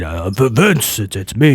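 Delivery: put-on low voice, stammering. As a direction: drop the voice far down, hesitantly. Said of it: "You taught me how to speak a little bit lower".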